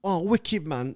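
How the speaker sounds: high, loud London lad; excited London bloke, mimicked; London accent. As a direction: put on a hyped-up London accent. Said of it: "these London dudes".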